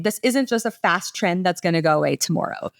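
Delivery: ordinary delivery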